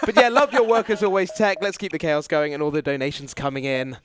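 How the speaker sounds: ordinary talk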